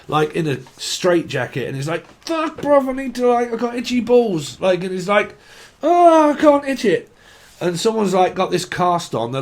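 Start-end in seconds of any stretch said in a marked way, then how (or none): 2.2-4.6 pitched way up, whining about the itch; high squeaky complaint. Retold or imitated. Straitjacket man voiced in bit. 5.8-7.1 high and loud, desperate he can't itch; loud high whine. Retold or imitated. Straitjacket man voiced in bit.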